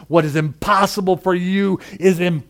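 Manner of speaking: usual speaking voice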